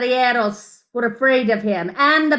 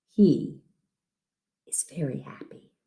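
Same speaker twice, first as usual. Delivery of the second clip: whispered and much softer; 9 semitones lower, 9 dB quieter than usual. A narrator's reading. Ending the bedtime story for a child, whispered. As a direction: breathe it out tenderly as the story closes.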